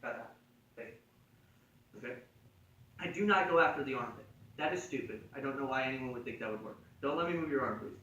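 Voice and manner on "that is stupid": ordinary talk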